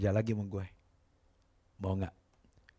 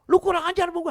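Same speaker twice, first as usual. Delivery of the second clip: high, loud angry insult; furious boss; 10 dB louder than usual. Retold or imitated. Boss yelling insult at the maid, loud and angry. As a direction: hurl it at her.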